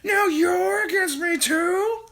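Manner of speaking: put-on high, slow, shrill old witch's shout; Grandma shouting. A narrator's reading. Grandma snapping at her daughter, high old-hag shout. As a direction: bellow like an outraged old hag. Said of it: "shouted Grandma".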